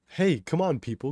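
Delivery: loud, high exasperated call, dropping at the end; yelling. A narrator's reading. Kato to the idle group, pleading yell. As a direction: yell to rally a crowd. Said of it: "Kato yells at the group".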